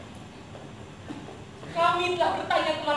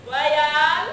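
loud call; 8 dB louder than usual. Acted. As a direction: call the name out. Calling out to friend.

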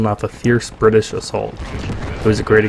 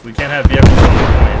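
much louder exasperated yell; frustrated shout; 2 semitones higher, 9 dB louder than usual. Real, not acted. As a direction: bark it in frustration. Frustrated under heavy attack.

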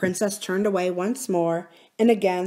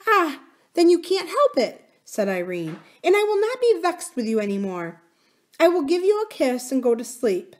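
high, bright and gentle forgiveness; a child forgiving her nurse; little princess; 9 semitones higher, 4 dB louder than usual. A narrator's reading.

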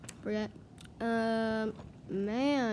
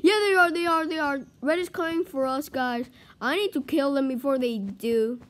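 loud, raised urgent callout; alarmed warning; 3 semitones higher, 7 dB louder than usual. Real, not acted. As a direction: warn the team in a hurry. Alerting team to incoming attack.

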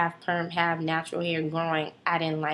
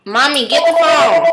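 much higher, much louder shout; yelling to mom; 10 semitones higher, 17 dB louder than usual. Real, not acted. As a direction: yell across the house for mom. Yelling to mom while recording.

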